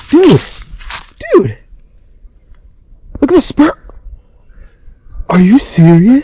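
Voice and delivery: loud, high amazed exclamations; excited disbelief. Real, not acted. Hunter seeing the turkey's huge spurs, loud amazed shouting. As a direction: shout it out, floored by the spur.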